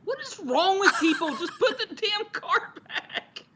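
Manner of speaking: high-pitched, drawn-out rant with a laugh in it; exasperated. Real, not acted. Listener fed up with people leaving carts, high exasperated rant. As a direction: plead in comic outrage, voice way up high. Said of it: "God, that makes me so mad".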